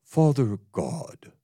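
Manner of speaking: slow, lightly solemn Father God; an understated reverent opening; mild venerable intoning; toned-down prayer voice. Retold or imitated. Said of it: "I'm not doing it the way he did it".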